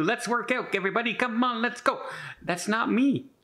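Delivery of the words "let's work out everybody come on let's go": higher, cheery fitness-video host voice; mock peppy instructor. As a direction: parody an upbeat workout leader rallying everyone. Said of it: "putting on exercise videos telling you".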